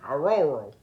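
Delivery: "A rural" said as if biting a burger; loud, mushy "A rural" like a full mouth; mouthful of burger. Shown as a demonstration. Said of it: "took a bite of a burger".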